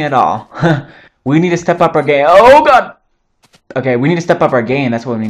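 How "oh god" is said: sudden high loud cry of alarm; startled yelp. Real, not acted. Gamer startled by a mob, yelps. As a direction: jump at a scare in the game.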